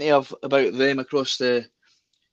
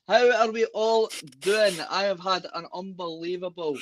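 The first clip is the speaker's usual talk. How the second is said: ordinary delivery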